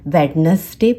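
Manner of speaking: वेडनेसडे as "wed-nes-day"; wrong वेडनेसडे with audible first D; three syllables in वेडनेसडे; D pronounced. Shown as a demonstration. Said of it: "अभी से सुधार लें".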